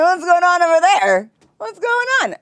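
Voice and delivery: very high, startled squeal; mock alarm. Mock alarm at friend's laugh.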